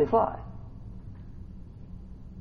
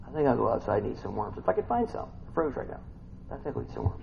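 mock self-pity; 9 semitones lower, 14 dB quieter, much faster than usual. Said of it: "You know my pity pot?".

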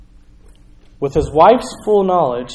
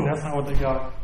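Holy Spirit voice; 4 dB quieter than usual. Retold or imitated. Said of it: "and he's saying".

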